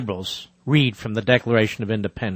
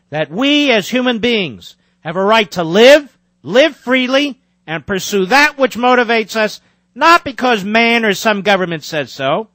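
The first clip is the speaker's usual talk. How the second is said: emphatic book reading, higher and louder than his chat; reading aloud; 9 semitones higher, 4 dB louder than usual. Acted. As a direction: read your own book like a speech. Reads own book aloud.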